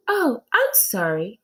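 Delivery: slow, soothing apology; smooth it over gently; apologetic teacher. Acted. Teacher apologizing to the monkeys, slow and soothing. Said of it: "said Missus Jules".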